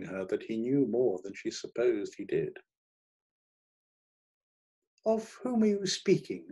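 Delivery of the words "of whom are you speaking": high-pitched alarmed question. A narrator's reading. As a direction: ask it high, in sudden alarm. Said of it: "she had asked in alarm".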